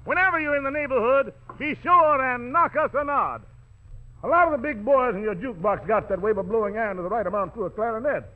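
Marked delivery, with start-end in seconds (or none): none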